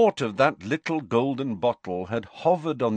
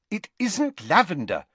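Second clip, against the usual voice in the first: higher, exasperated retort; impatient; 11 semitones higher than usual. A narrator's reading. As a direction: cut him off, fed up. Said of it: "said Hermione impatiently".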